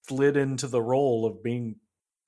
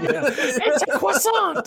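snooty high voice; 14 semitones higher, slower than usual. Acted. Co-host answers in voice.

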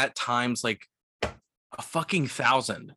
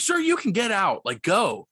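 firm dismissal; 8 semitones higher than usual. Retold or imitated. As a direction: snap at the guest to get out. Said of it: "So I'm like".

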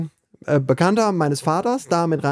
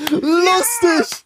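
delighted exclamation; 12 semitones higher, 3 dB louder than usual. Real, not acted. Reacting to the revealed identity.

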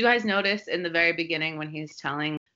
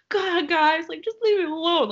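higher, louder fed-up plea; mock exasperated outburst at pestering guys; 14 semitones higher, 5 dB louder than usual. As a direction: act out being totally fed up. Acting out her exasperated line at party.

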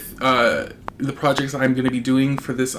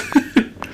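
breathy laugh; 9 semitones higher than usual. Real, not acted. Laughing at own hiccups.

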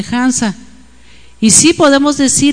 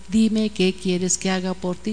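slow, soft, lowered voice; tender asking; 4 semitones lower, 7 dB quieter, slower than usual. Retold or imitated. Jesus turns back and asks the blind man, tender asking. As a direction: ask softly and unhurriedly.

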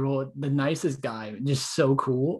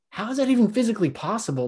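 disbelief; 8 semitones higher, faster than usual. Retold or imitated. Disbelief at coach's impossible pressure.

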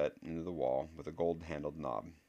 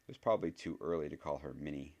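usual speaking voice

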